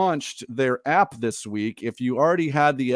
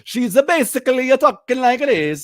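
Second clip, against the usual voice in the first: mocking old movie voice; 10 semitones higher, 5 dB louder than usual. Mocking her bad Italian accent.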